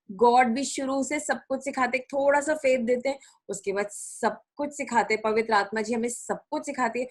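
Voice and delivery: ordinary talk, nothing marked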